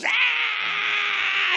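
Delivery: sudden high pained yelp; retold yell of pain. Fishing buddy hit by the hook yells out in pain. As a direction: yelp like the hook just hit your head. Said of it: "and Nance goes".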